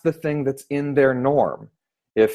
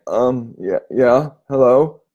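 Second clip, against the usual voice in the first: sleepy; slower than usual. Retold or imitated. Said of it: "He was asleep".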